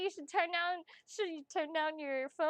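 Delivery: usual speaking voice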